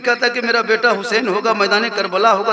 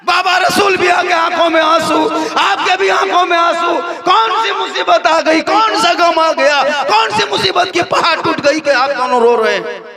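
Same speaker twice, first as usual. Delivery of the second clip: wailing lament; 11 semitones higher, 5 dB louder than usual. Acted. Fatima pleading with her weeping husband, high wailing lament. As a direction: cry it out like a grieving wife begging an answer. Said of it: "तो हज़रत फातिमा फरमाती है".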